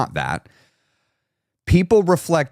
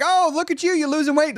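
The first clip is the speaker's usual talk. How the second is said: mock friend. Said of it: "They're like".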